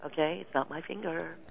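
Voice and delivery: hushed reassuring mantra; quiet self-talk. Retold or imitated. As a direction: talk yourself down gently. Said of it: "say to myself".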